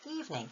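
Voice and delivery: Evening with the first syllable stressed, falling. Shown as a demonstration. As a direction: land firmly on EVE in Evening.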